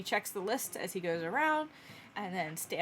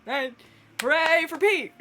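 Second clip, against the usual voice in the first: loud, raised host voice; fumble, then cheer it on for the crowd; awkward announcer; 5 semitones higher, 8 dB louder than usual. Acted. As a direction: thrown emcee rallying applause. Groundhog announcer covers awkward introduction.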